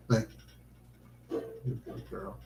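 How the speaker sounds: whispered, tender, unhurried. Real, not acted. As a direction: breathe it softly to the dog. Tender words to dog.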